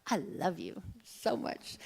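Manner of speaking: soft, slow and tender. Real, not acted. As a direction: say it gently to every listener. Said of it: "my kind word for you today is".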